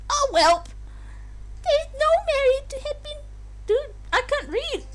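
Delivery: very high haughty princess voice; evil Peach voice. Acted. Streamer voicing the princess's ruling in an evil high voice. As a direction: sneer it out high as evil royalty. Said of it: "Evil Peach".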